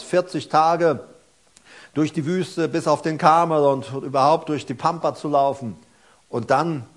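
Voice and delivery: loud, driving sermon delivery; full-force preaching. Acted. Preacher telling the congregation about Elia, at full force. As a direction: preach it out to the whole hall.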